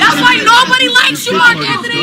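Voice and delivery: high, fast outburst; angry scolding. Real, not acted. Protester scolds Mark Anthony during a street row, high and heated. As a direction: tell him off in front of everyone.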